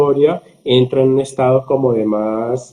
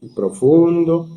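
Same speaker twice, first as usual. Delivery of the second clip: slow and deep; slower than usual. Shown as a demonstration. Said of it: "yo le puse a respirar conmigo".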